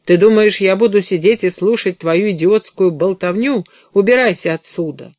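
spiteful, forceful dismissal; angry outburst. A narrator's reading. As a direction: lash out to drive someone away. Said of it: "Она злобно ткнула в него".

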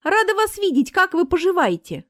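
high-pitched, friendly enthusiasm; chirpy small talk; delighted greeting. A narrator's reading. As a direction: gush that you're glad to see them. Marina greets an acquaintance happily.

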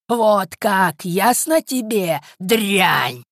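high, loud, slowly stretched menace; crazed threatening old man. A narrator's reading. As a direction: rant slowly with mounting malice. (безумная злобная угроза старика, громко и высоко)

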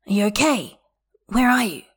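urgent, worried questions; concerned and insistent; anxious. A narrator's reading. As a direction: press them, voice rising on the second question. Anxious questions after crying call.

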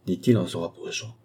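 usual speaking voice

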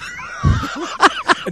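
real burst of laughter, very high; shrieking laugh; high laughing shout. Real, not acted. Laughing at own bit.

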